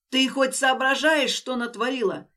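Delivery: shout. Acted. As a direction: yell it like a whip crack. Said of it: "Крик Дэна со свистом рассёк воздух".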